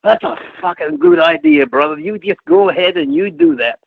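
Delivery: high, lisping put-on promoter voice; high-pitched mimicry of a lisping wrestler; Dusty Rhodes. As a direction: imitate the boss giving his hearty go-ahead. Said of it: "that's how he talks in his Dusty Rhodes voice".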